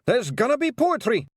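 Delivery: high, loud warning yell; alarmed yell. A narrator's reading. Rob warning the clan, high loud yell. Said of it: "he yelled".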